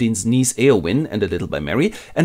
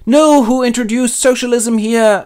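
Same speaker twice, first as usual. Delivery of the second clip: much higher, louder, slow and altered; mock outraged hobbit; 12 semitones higher, 6 dB louder than usual. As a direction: wail in comic outrage. Hobbits dismayed at the Shire.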